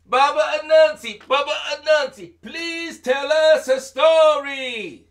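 put-on high, loud pleading children; children begging. Children pleading Anansi for a story, high and loud. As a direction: beg like eager little kids.

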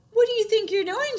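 high, loud indignant bird squawk. A narrator's reading. Bird scolding the elephant for splashing, squawking. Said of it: "she squawked".

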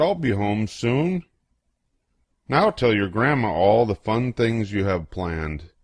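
plain reading voice

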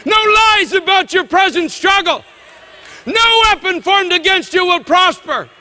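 high, loud declamation building to a peak; full-force preaching. Real, not acted. Preacher proclaiming no weapon shall prosper, full-force preaching. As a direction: hammer out each promise with ringing force.

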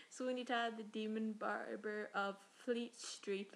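slow, carefully spelling out Fleet Street; each word laid down slowly after stumbling; slow careful correction. Shown as a demonstration. Getting the title right, slowly and deliberately. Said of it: "Look at that, I said it".

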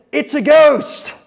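a high, slow, fearful cry of terror; frightened cry. Acted. Disciples in the storm-tossed boat, crying out in terror. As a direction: cry it out like a sailor seeing a ghost. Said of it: "They cried out in fear".

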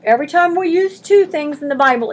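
ordinary delivery

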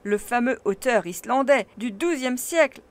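loud, eager lecturing professor; proud scholar showing off his book; animated uncle. A narrator's reading. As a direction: declaim it with growing excitement. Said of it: "en s'animant".

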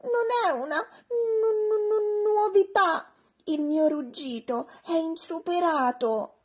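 high, stammering bragging voice; brag, tripping over the word; show-off dinosaur voice; boastful dinosaur. Acted.

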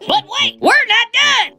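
high, loud "but wait"; excited ad pitch. Acted. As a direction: hype the next offer. Infomercial exclaim.

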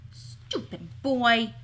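slow, drawn-out grumble; exasperated mutter, each word stretched; muttered insult. A narrator's reading. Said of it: "Carmen muttered to Waif".

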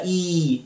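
"إي" held as a long ee; long ee sound. Shown as a demonstration. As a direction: stretch "إي" like the vowel in heat. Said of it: "المفروض أنطقها".

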